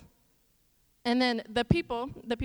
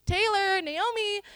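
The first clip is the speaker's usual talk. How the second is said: higher mock call of Naomi and Taylor; retold calling out; 8 semitones higher, 4 dB louder, slower than usual. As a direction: call the kids' names across the fields. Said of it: "they start yelling".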